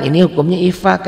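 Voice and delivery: normal talking voice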